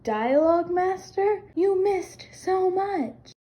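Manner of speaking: high, slow mocking tone. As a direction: tease her slowly from up high. Friend mocking the host.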